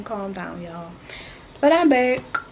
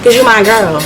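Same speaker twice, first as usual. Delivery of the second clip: mock rant. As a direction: rant at Kim like a wronged fan. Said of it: "my little rant about Skims".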